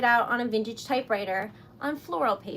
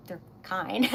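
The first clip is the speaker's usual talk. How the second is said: laughing; 9 semitones higher, 10 dB louder than usual. Real, not acted.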